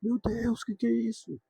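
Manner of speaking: high, loud retold panic of a mother; a mother's high panicked yell at her bleeding child; panicked cry. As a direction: cry out in fright at the sight of blood. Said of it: "minha mãe deu um grito de pânico".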